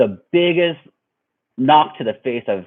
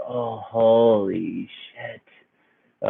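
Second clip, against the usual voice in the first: drawn-out, softer mock groan; stunned; 5 dB quieter, much slower than usual. Victim laid out on the ground, stunned slow groan. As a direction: drag it out slowly, as if dazed.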